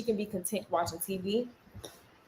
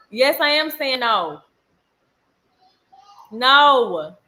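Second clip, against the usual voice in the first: loud, slow, drawn-out no; firm refusal; 4 semitones higher, 7 dB louder, much slower than usual. Real, not acted. Refuses her child out loud.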